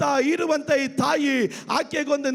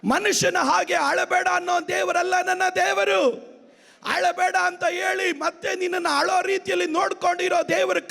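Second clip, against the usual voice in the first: high, driving sermon shout; full-force preaching; 5 semitones higher than usual. Real, not acted. (ಪೂರ್ಣ ಶಕ್ತಿಯ ಉಚ್ಚ ಸ್ವರದ ಪ್ರವಚನ)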